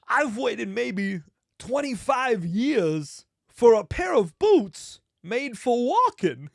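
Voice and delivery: slow, loud, high halting delivery; hammed-up celebrity impression, words stretched out; Christopher Walken. Movie star awaiting boots for years, hammed-up impression. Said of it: "Christopher Walken just going".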